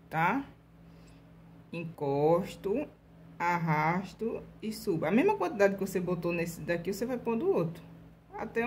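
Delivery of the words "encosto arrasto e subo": slow demo. Shown as a demonstration. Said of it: "same steps repeated slowly".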